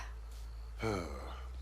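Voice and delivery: soft breathy sigh, falling away; a quiet, weary exhale; heavy sigh. Real, not acted. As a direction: let out a tired sigh before answering. Asked about dead soldier.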